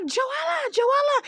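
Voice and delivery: high frantic calls. Retold or imitated. Friends trying to pull her off, calling her name high. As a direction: yell her name to stop her. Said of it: "they're like".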